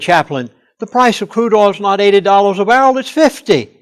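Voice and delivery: retold correction, pitched high and loud; quick high protest, punching the final figure; comical insistence. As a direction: imitate an amused insider setting someone straight. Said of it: "This was so comical".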